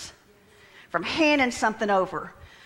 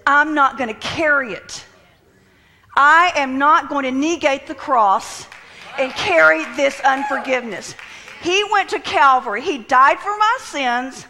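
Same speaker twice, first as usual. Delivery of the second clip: loud, emphatic preacher's proclamation; full-force preaching; 4 semitones higher, 7 dB louder than usual. Acted. Preacher refusing to carry unforgiveness, full-force preaching. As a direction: drive each phrase home loudly.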